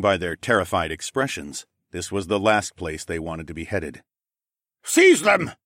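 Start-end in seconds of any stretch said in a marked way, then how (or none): 4.8-5.5 loud, high barked order; commanding shout. Acted. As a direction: order the guards to act. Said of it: "Gris commanded".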